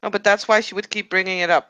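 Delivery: loud, heated shout. Acted. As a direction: yell it in frustration. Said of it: "I didn't mean to shout".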